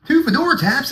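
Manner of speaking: high-pitched disbelieving gasp of a line; mock disbelief. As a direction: pretend to be stunned by the offer. Mock amazed reply to himself.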